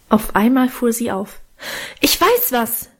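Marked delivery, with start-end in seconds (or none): 2.0-2.9 higher, sudden excited exclamation; calling out. A narrator's reading. Guste jumps up with an idea, calling out. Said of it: "rief sie so laut".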